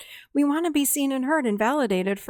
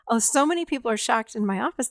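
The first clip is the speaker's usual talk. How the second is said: ordinary talk, nothing marked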